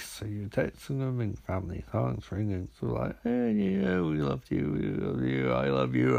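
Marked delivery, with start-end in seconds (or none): 3.2-4.7 higher, sing-song loving reply; mock family sweetness; sweet family reply. Family texting back in dream. 4.7-6.2 lower, heavier loving reply; deep mock family voice. Family texting back in dream.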